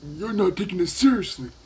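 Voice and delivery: mocking the teacher. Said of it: "he's like".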